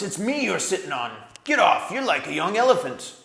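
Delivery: furious dwarf swearing in the dark; angry dwarf. A narrator's reading. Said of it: "came an angry voice".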